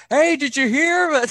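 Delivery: high, loud neighbor calling across the street; gossipy neighbor call. Retold or imitated. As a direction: shout the gossip across the road. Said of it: "they were like".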